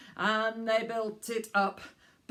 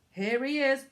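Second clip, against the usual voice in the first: usual speaking voice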